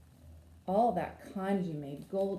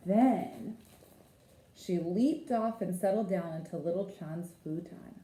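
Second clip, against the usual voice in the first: usual speaking voice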